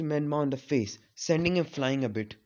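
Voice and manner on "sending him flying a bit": ordinary delivery, nothing marked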